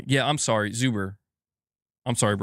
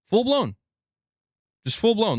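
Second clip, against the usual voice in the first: amazed exclaim; 11 semitones higher than usual. Real, not acted. Amazed at instant AI thumbnail.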